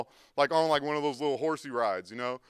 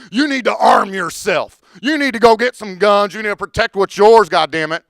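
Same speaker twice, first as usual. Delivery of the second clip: mocking the sheriffs; 3 semitones higher, 11 dB louder than usual. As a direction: bellow it like a gun-happy sheriff. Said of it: "came out and said".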